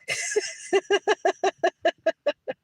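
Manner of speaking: high, long chuckling run; real laughter. Real, not acted. Streamer laughing about sitting home relaxing, real laughter. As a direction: crack up at your own daydream.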